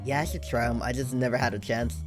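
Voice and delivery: usual speaking voice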